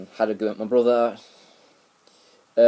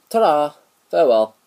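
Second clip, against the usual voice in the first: cheery sign-off; 5 semitones higher, 7 dB louder than usual. Real, not acted. Loud cheery farewell.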